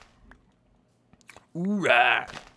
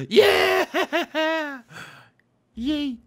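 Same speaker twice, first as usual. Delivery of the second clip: shouting with joy; big happy yell; excited cheer; 7 dB louder than usual. Real, not acted. Horse tamed, shouts in joy.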